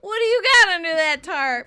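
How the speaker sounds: high, loud theatrical bellow; random stage quote. Acted. Host randomly quoting the play at home, hammed-up shout. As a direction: belt it out like a stage line. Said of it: "I will randomly ask these questions".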